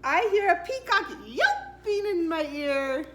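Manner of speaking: ordinary delivery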